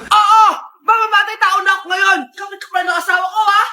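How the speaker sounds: comic jealous outburst; furious confrontation. Acted. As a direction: lash out at a love rival. Confronts neighbour over husband.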